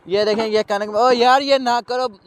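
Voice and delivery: loud slow call across the field; shouted warning to the workers; shouting out. Real, not acted. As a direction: yell to stop them, drawn out. Calls out to people in field.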